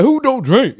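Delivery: high, loud incredulous question; mock disbelief. Man mocking someone who doesn't drink, mock disbelief.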